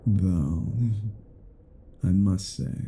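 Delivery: usual speaking voice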